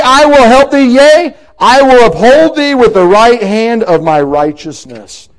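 loud, high, ringing pulpit reading, slowing on the promises; full-force preaching of the verse; preaching scripture. Acted. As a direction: thunder the verse out to the church.

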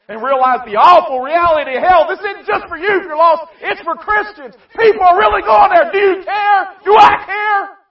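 high, very loud shouting sermon; preacher's shout. Acted. Preacher shouting at Christians whether they care, high full shout. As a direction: cry out the challenge at full force.

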